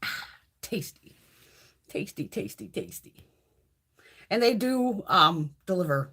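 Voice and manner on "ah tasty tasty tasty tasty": savoring whisper. Real, not acted. Savoring a sip of drink.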